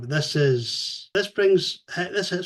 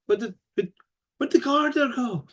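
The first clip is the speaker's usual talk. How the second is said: high puzzled mock voice; confused; 9 semitones higher than usual. Confused robots wondering where the exit went. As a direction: act baffled, voice jumping up.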